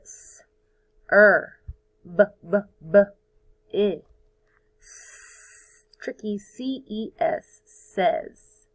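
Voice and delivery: "S, er, b" sounded out, "C E S" whispered; spelling out sounds. Shown as a demonstration. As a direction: sound it out, whisper the tricky part. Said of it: "Repeat it and write it".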